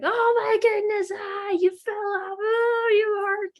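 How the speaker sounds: put-on high, wailing panic over a fallen child; freaking out. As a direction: act a hammed-up parental freak-out. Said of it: "if you're freaking out".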